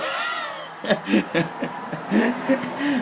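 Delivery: high, loud shouting laugh; real laughter bubbling up; burst of laughter. Real, not acted. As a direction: let the held-in laugh explode. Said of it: "That was repressed laughter".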